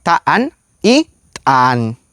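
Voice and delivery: «taan» long, «tan» glottalized, said slowly; each word set carefully apart; contrasting the sounds. Shown as a demonstration. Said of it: "no es lo mismo decir". (contraste lento entre taan y tan)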